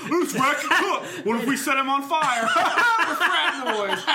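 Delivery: high loud laughing frat bro; rowdy frat boy shout. Acted. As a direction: yell it like a drunk frat boy. Friends voicing rowdy frat boys.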